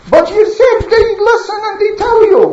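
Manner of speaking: high-pitched, innocent-sounding objection; acted puzzled protest. Nuh protests to idol servant.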